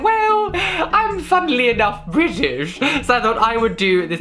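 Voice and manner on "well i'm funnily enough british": high, drawn-out mock-grand announcement; hammed-up high-pitched declaration; playful self-quote. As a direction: sing it out high and slow. And I was like.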